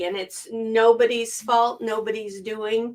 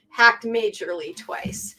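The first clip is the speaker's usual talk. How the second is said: ordinary talk, nothing marked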